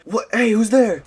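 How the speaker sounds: high, loud call into the dark; who's there, shouted nervously; calling out scared. Retold or imitated. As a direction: shout at the bushes. Calling out at rustling bushes.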